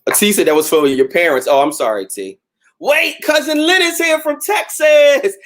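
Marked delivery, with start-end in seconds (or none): 2.8-5.4 excited shout. Real, not acted. Spots cousin in live comments.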